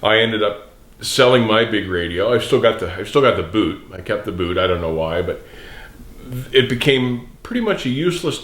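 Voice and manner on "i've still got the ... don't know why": ordinary delivery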